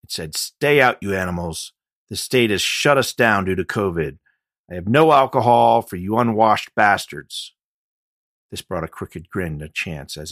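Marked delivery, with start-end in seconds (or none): 0.4-7.5 angry shout. Acted. Angry note read aloud.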